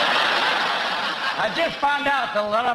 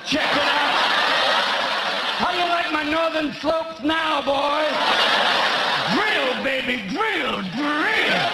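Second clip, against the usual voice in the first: loud, raised, hammed-up chant; brash rally cry; 4 semitones higher, 3 dB louder, slower than usual. Palin flaunting herself to the boys on election day, loud brash chant. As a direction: rouse the boys like a cheering rally. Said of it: "she'd just be like".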